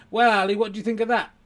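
high, loud, quick stage question; ventriloquist act. Acted. Ventriloquist asks Ali's opinion, high quick patter. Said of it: "here's my act".

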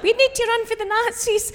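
high little figure voice; very high toy voice; child's toy voice. Retold or imitated. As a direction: squeak like a playing child. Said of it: "then it's like".

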